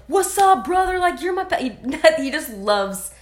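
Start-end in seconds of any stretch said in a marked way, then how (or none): 0.1-1.6 high, loud mock Dennis greeting; hammed-up bro greeting, pitched way up; buddy-buddy impression, loud and high; imitating Rodman. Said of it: "he's like".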